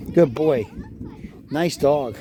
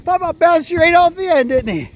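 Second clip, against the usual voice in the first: high, loud gleeful teasing; playful teasing; 12 semitones higher, 5 dB louder than usual. Real, not acted. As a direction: tease him with glee. Teasing grandson after bounce.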